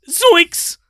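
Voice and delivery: very squeaky cartoon yelp; high-pitched frightened exclamation; Shaggy. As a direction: yelp it like a scaredy-cat cartoon. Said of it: "can you give us a zoinks?".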